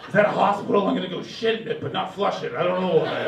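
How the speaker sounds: loud blunt mock politician rant; modern politician. Mocking today's politicians as bridge trolls, loud and blunt. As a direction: blurt it out like a shameless oaf. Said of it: "all these fucking bridge trolls".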